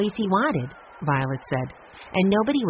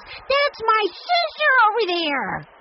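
much higher, louder, slow shout; top-of-voice shout; 4 dB louder, slower than usual. A narrator's reading. Benny shouts to the crowd that Jessie is his sister. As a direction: yell it proudly to the whole crowd.

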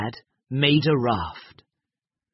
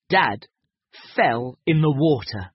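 plain reading voice

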